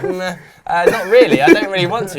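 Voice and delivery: hesitant, unwilling reply; reluctant. Retold or imitated. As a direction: drag it out reluctantly.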